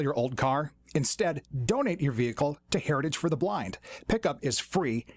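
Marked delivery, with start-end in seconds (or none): none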